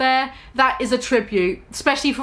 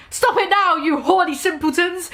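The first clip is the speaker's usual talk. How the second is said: higher, mock-stern teacher shout; 6 semitones higher than usual. A narrator's reading. Professor McGonagall catching two students, higher mock shout. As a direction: scold them like a prim professor. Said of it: "shouted Professor McGonagall".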